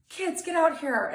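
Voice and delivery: put-on high, loud call to the kids; frantic mom summoning her children. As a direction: yell for the kids across the house. Mom calling kids in panic.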